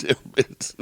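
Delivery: whispering the pun; breathy whispered repeat. Shown as a demonstration.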